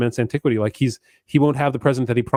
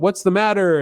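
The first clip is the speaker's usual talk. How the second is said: calling out; 8 semitones higher than usual. Retold or imitated. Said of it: "And then he calls out".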